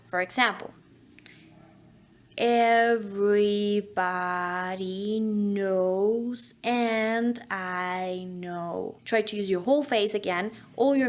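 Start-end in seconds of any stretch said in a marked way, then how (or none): 2.4-9.0 drawn out at a crawl, every vowel stretched; very slow. Shown as a demonstration. Voice teacher to students, demonstrating an extremely slow diction drill. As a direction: say it as if in slow motion. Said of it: "we're going to start slow".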